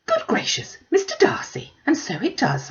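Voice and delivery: breathy, very high shocked exclamation; startled gasp. Acted. Mother gasping at seeing Mister Darcy.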